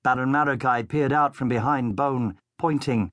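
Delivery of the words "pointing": plain reading voice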